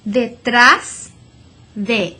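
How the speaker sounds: "detrás" slow, last syllable trás punched; stress on trás. Shown as a demonstration. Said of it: "stress that last syllable".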